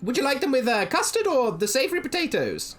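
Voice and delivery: high sweet gnome shopkeeper; gnome woman voice. Acted. Gnome woman serves Blossom, high sweet voice. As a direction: coo it like a kindly gnome granny.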